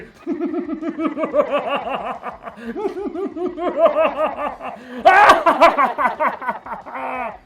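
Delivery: long, high, loud evil cackle; over-the-top villain laugh. Pretend. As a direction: maniacal laugh through a voice modulator. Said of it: "Sorry, I went a little overboard there".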